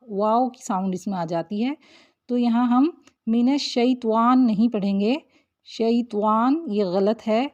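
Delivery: ordinary talk, nothing marked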